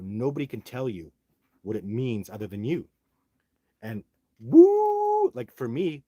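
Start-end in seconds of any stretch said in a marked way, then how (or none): 3.8-5.3 high loud woo call; Sasquatch call. Shown as a demonstration. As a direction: belt a high whooping woo. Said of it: "My Sasquatch call is, it sounds like Ric Flair".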